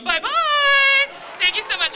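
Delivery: very high, loud playful bye-bye; sing-song goodbye. Real, not acted. Comedian signing off to the audience, high loud chirp. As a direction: chirp it out to the crowd.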